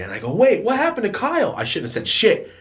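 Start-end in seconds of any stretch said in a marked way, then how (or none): 0.3-1.5 high, loud overdone innocence; feigned surprise. Retold or imitated. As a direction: blurt it out as if clueless.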